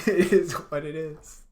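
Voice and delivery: high, drawn-out amused repeat; slow echo through real laughter; laughing echo. Real, not acted. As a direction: laugh while parroting a catchphrase. Laughing echo of friend's catchphrase.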